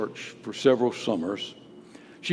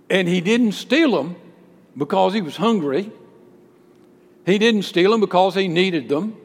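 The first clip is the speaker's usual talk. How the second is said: louder, rising pulpit build; building preaching; 9 semitones higher, 8 dB louder than usual. Acted. Preacher building the Augustine story, rising and loud. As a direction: climb toward the point, stronger each line.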